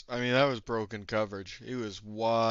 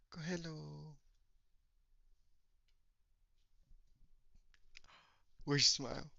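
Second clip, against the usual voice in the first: bedtime talk to pet; 4 semitones higher, 8 dB quieter than usual. Real, not acted. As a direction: coo gently to a sleepy little one. Putting little one to sleep.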